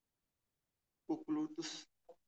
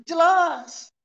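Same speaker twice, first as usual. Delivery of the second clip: shouted point; 10 semitones higher, 17 dB louder than usual. Acted. Preacher to the congregation, shouting "Jelas" with full force. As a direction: shout jelas much higher and louder.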